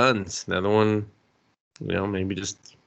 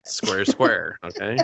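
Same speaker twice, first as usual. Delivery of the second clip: fast smooth talker. Retold or imitated. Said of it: "He's just like".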